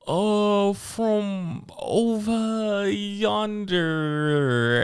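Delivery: high, drawn-out mock British; hammed-up British, slow and lofty; fake British accent. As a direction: stretch it out in a bad British accent. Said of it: "talking my British".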